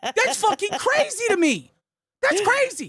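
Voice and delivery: can't keep a straight face; laughing. Real, not acted. Laughs at the accusation.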